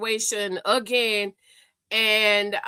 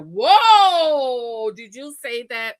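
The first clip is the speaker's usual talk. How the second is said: shocked whoa; 7 dB louder, much slower than usual. Retold or imitated. The man reacting in shock.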